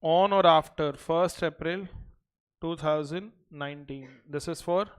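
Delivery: slow, even dictation pace. Acted. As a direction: read it out for notes. Dictates notes to class.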